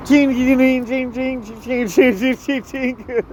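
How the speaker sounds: high-pitched repeated chinging noise; imitated coin jingle. Mimicking coins after asking for spare dollars, imitated jingle.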